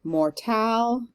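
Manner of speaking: "Mortal" with the weight moved to "tal"; light "Mor", stressed "tal". Shown as a demonstration. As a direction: raise and hold "tal" in "Mortal". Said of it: "Emphasis on the tal part of that word".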